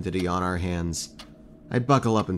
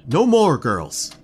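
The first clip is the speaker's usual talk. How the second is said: sharp raised warning; loud scolding; 8 semitones higher, 8 dB louder, much slower than usual. Acted. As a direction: shout to stop them. Telling girls to stop drinking.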